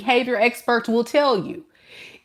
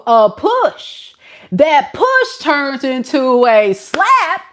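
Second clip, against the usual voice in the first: slow, rising louder and higher with each step; building intensity; 5 semitones higher, 4 dB louder, slower than usual. Real, not acted. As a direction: build it up link by link, slower and heavier. Escalating list, building.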